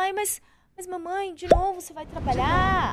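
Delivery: high-pitched mock child's protest; pleading kid voice, pitched well up; complaining child. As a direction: imitate a little daughter objecting to mum leaving.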